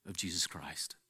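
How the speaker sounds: hushed whisper; whispered with reverence. Acted. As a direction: drop to a breath for the name. Sudden hushed close of question.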